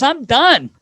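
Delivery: high, loud mock declaration; Phil Kessel. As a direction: imitate a fed-up teammate. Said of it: "He goes".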